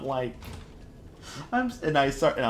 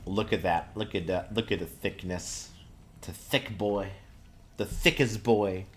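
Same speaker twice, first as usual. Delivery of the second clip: usual speaking voice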